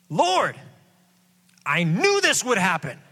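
high, complaining prophet voice; whiny Jonah. Acted. Preacher voicing Jonah complaining to God, whiny and high. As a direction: whine it like a sulking prophet.

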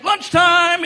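loud, high sing-out call; mock cheerful bail-out. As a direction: call it out as a comic escape. Faith gone, comic escape line.

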